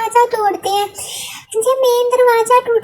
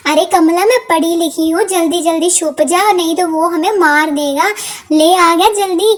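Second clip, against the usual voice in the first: a lower, grumbling sidekick voice; low-voiced partner; 6 semitones lower than usual. Partner nagging Kamla about the screwdriver, lowered voice.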